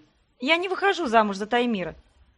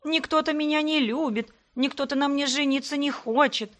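high, mock-pitiful whine; a teasing wail that nobody loves her; whiny voice; 6 semitones higher than usual. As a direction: keep the self-pity act going. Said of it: "противным голосом проныла".

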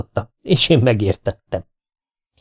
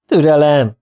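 louder, higher call; 5 semitones higher, 5 dB louder than usual. A narrator's reading. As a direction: call it out cheerfully. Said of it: "kiáltotta a két vadász".

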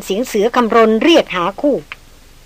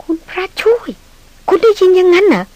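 much higher, startled disbelief; a stunned woman, louder and higher; shocked gasp; 9 semitones higher, 4 dB louder than usual. Acted. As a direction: blurt it out in alarm. Darin shocked by his account.

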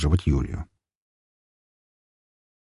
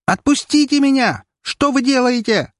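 put-on high, panicked girl's cry for release; panicked scream. A narrator's reading. Girl screams at police in panic, high and terrified. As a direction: scream in terror at your captors. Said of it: "Испуганный и полный паники вопль".

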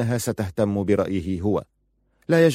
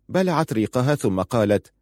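ordinary delivery

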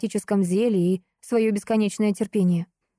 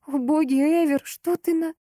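high, tearful, horrified cry; tearful hoarse cry; 8 semitones higher than usual. Acted. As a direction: voice shaking with tears. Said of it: "голос был хриплый, в нём дрожали слёзы".

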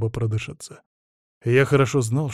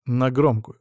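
usual speaking voice

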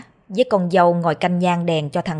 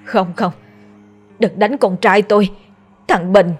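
panicked cry, rising on Bình; a mother screaming from a nightmare; frightened outcry; crying out in fear. Acted. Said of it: "Bỗng bà cả bả la lên".

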